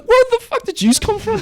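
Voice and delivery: very high, incredulous squawk; incredulous outburst. Retold or imitated. Teller baffled by woman arriving with kids, incredulous squawk. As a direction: voice shoots up in acted disbelief. Acted reaction to sudden nine kids.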